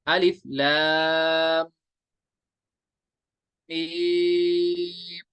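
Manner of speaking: "लाम" stretched into a nasal hum, "मीम" held long; nasal ghunnah recitation. Shown as a demonstration. Teacher showing students where ghunnah falls, nasal and drawn out. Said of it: "नाक में आवाज रखेंगे".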